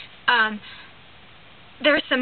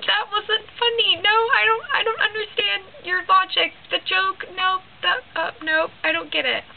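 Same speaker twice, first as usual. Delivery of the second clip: much higher, put-on whiny protest; mock-indignant students; 8 semitones higher than usual. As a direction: complain you don't get the joke. Students protesting the bad joke.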